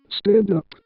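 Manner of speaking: ordinary delivery, nothing marked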